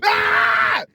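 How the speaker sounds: breathless, unvoiced yell of frustration; frustrated scream. Ending the retold meltdown over her name, with an unvoiced scream. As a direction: let out a short, raw scream.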